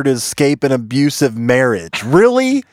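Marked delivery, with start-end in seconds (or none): 2.0-2.6 high, loud disbelief; scoffing at a ridiculous claim; incredulous. Real, not acted. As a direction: really, come on now. Mocking the article's claim.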